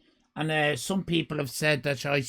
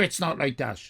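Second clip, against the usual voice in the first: ordinary talk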